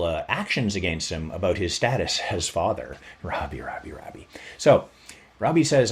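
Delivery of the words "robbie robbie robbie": breathy unvoiced whisper of the name; whispering his name. Storyteller whispering Robbie's name to the audience, whispered. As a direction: hush it, like calling someone secretly.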